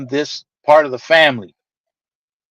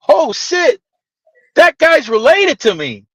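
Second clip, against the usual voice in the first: surprised; 7 semitones higher than usual. Retold or imitated. Learning the guy is related.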